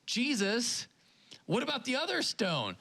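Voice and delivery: a disciple suddenly realizing he's lost out; whiny Peter. Retold or imitated. Peter asking Jesus about his missing bread, high whine. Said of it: "And then Peter's like".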